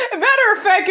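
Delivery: high squealing laugh into the words. Real, not acted. Vlogger laughing at Jalen's paused face, high squeals. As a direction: still cackling as you talk.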